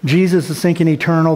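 normal talking voice

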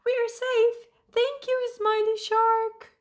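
high-pitched, loud group-style thanks; jubilant cheering. A narrator's reading. Freed fish thank Smiley Shark, jubilant cheer. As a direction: sing out relief in a high little voice. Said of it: "cheered the fish".

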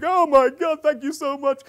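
high, loud, breakneck gratitude gush; hammed-up emotional thanks; overwrought mock cry. Woman thanking her coach, mock overwhelmed gushing. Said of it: "she was beginning to cry".

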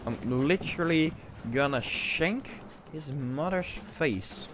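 low, quiet simmering anger; seething threat. Real, not acted. Gamer threatening whoever messaged him, quiet seething. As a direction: mutter the threat low and measured.

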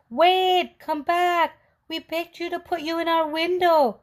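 high, loud calls to a runaway; calling after him. Acted. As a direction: shout after the fleeing cookie. Old couple calling after cookie.

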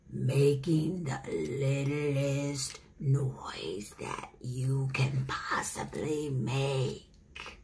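groundhog voice, dropping to whispers; wise old groundhog. Acted. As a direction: hush it down like a wise old critter. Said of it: "a wise old groundhog".

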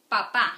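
"Papá" loud, with a punched final "pá"; emphatic model of "Papá", stressed at the end; last syllable stressed. Shown as a demonstration. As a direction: hit the second PÁ in "Papá". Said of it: "the strong one is there".

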